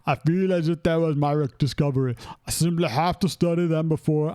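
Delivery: put-on Arnold-style accent, amateurish; hammed-up, heavily accented action-star imitation. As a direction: read it as a bad celebrity impression. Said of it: "Read it like Arnold".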